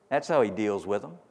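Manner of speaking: ordinary delivery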